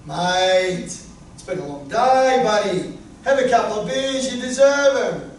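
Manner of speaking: a hint of a matey tempter pushing beers; tempting Fat Wayne. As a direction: Fat Wayne coaxing him toward the minibar beers. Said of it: "Fat Wayne will see the beers in the minibar and say".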